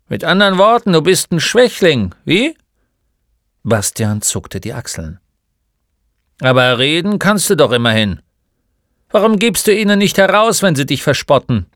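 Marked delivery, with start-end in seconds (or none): 0.1-2.6 loud, high-pitched needling; scornful taunt. Acted. Old shopkeeper mocks the boy as weak, loud and scornful. 6.4-11.7 loud, higher, quickening questions; challenging old shopkeeper. Acted. Old man urges the boy to talk back, loud and impatient.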